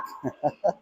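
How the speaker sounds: soft leftover chuckle; laughing. Real, not acted. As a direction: let a quiet laugh slip out mid-commentary. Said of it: "I'm laughing about something else".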